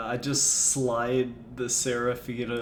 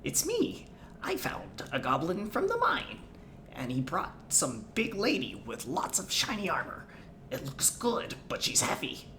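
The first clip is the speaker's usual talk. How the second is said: goblin voice; 3 semitones higher than usual. Acted. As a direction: chatter like a scheming goblin. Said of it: "Goblin voices".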